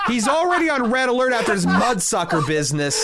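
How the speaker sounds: words bubbling through real laughter; laughing. Real, not acted. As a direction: crack up as you talk. Laughing while riffing on Mister T.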